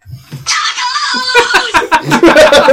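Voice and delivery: very high, loud laughing shriek; high-pitched laughing fit; real laughter. Real, not acted. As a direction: burst out in loud cackles. Host bursts out laughing.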